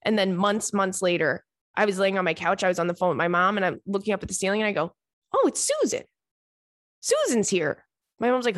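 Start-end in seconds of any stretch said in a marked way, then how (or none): 5.3-7.8 high sudden "Oh, it's Susan"; delighted recognition of a lost pet; delighted surprise. Retold or imitated. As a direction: squeal of recognition at the ceiling. Spotting the lost ladybug.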